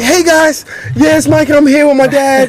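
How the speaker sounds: put-on very high, chirpy kid voice; squeaky, pitched-way-up impression of his son; young son vlogging. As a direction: imitate a kid greeting YouTube viewers. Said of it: "It was like".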